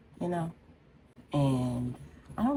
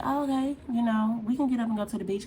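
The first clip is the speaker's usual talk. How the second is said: eager agreement; 10 semitones higher, faster than usual. Retold or imitated. Retelling own eager reply.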